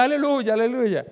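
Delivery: high, loud, jubilant cry; shouting hallelujah. Retold or imitated. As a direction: imitate a convert yelling out praise. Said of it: "entonces grita".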